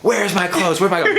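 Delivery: high, frazzled re-enactment; comic dazed fluster; mock panic. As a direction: play at being frantic and lost. Re-enacting his dazed clothes search.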